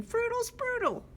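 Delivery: high, squeaky silly birdcall; playful high nonsense chirp; silly bird song. Acted. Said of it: "Oh, that's very silly".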